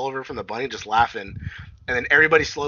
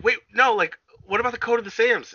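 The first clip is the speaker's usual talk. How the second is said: pleading; 11 semitones higher, 3 dB louder than usual. Retold or imitated. Pleading character in retelling.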